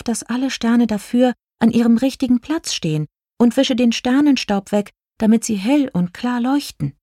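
high-pitched, earnest fairy voice; little star fairy explaining. A narrator's reading. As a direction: explain yourself in a small high voice.

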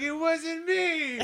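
high, slow mock innocent whine; denying it. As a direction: whine your innocence, hammed up. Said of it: "And, like".

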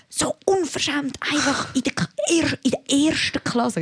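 put-on high, slow, outraged old-woman nagging; drawn-out, high-pitched mock indignation; imitated scolding. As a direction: mimic an old woman griping at a stranger. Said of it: "wie sie mich anwäffelt".

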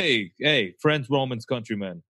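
joking New York Italian take on Shakespeare; New York Italian accent. Acted. Playing along with co-host's joke, mock New York Italian. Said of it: "like, New York Italian".

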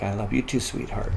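soft and gentle, to a little daughter; quiet loving goodbye to his daughter; tender reply. Retold or imitated. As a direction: say it softly, with a father's tenderness. Father answers his daughter.

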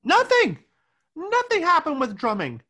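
high, loud flat denial; emphatic. Real, not acted. Answering her co-host, a high loud emphatic no. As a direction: slam the word down hard.

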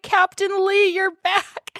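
very high, tearful imitation; mock crying. Recapper voicing the kid reunited with his captain, mock crying. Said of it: "the kid starts crying and he's like".

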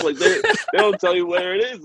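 giggling as he tells it; laughing. Real, not acted. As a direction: crack up mid-sentence. Laughing while telling the story.

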